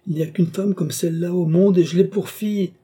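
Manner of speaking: high, indignant lament; father's exasperated outburst; exasperated exclamation. A narrator's reading. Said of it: "s'écria Tavernet".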